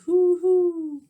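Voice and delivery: high, loud hooting owl; playful owl call for the children. A narrator's reading. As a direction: hoot it out like an owl. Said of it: "The owl says".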